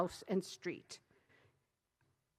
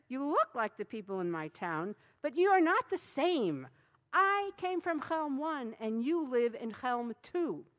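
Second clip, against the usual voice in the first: Schlemiel's voice; 5 semitones higher, 4 dB louder than usual. A narrator's reading. Schlemiel explains.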